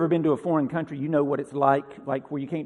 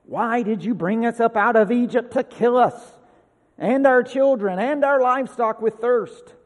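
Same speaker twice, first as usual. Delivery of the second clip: higher, louder acted protest; grumbling accusation; 6 semitones higher, 4 dB louder than usual. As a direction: complain accusingly, voice raised. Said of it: "the people grumbled".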